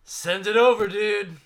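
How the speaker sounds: loud, high call to a friend. Retold or imitated. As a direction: shout it out to your buddy. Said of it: "And I'm like".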